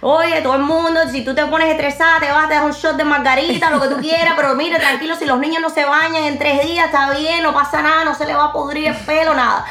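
breezy Cuban mom telling moms to relax; Cuban accent. Shown as a demonstration. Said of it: "I was doing it with a Cuban accent".

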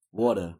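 low, falling New York "water"; "water" with a New York "waw" vowel; New York pronunciation of water. Shown as a demonstration. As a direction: demonstrate how New Yorkers say "water". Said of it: "New Yorkers say water weird".

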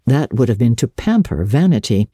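plain reading voice

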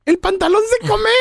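high-pitched imitation of a child's question; voz de su hijo. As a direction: mimic your little son asking Dad. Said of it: "después me dijo". (voz aguda de niño)

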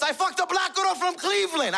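high-pitched mock panic; panicked boast. Father proving himself to his son, panicked boast. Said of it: "Then he panicked more".

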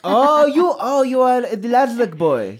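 put-on loud, high French-accented twin; french chef. Twin recognizes the boy.

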